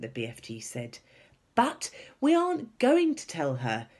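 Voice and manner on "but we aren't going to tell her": high, loud, eager; pitched-up, emphatic eagerness; excitedly. A narrator's reading. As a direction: reveal your plan with excitement. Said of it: "Sophie said excitedly".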